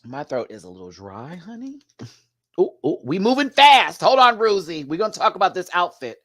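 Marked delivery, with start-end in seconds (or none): 1.4-1.8 high, soft croak on honey; squeaky honey. Pretend. Vlogger croaks honey with a dry throat. 2.6-6.2 higher, louder ooh-ooh rush; excited. Pretend. Vlogger excitedly stops the fast-moving episode.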